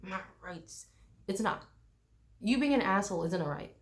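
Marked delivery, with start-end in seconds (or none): 0.0-0.9 fading whiny "my rights" repeated; mocking an entitled tourist trailing off; hammed-up entitled whine that fades. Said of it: "They're like".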